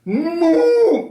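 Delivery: high, loud cow moo. A narrator's reading. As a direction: burst out mooing. Animals attack the farmer.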